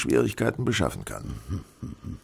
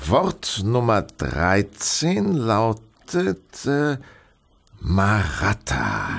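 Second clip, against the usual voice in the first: slow deciphering; much slower than usual. Acted. Holmes decoding word by word.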